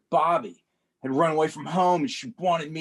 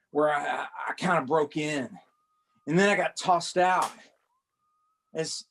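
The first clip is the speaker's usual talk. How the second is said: normal talking voice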